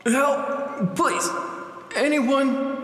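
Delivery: high, strained cry for help; frantic pleading shout; desperate cry. Acted. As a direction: call out while bleeding to death. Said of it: "Desperate, you cry out".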